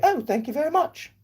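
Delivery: very high-pitched regal British voice; reply as a squeaky English monarch; high, dainty British royal falsetto; the Queen. Acted. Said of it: "And she'd say".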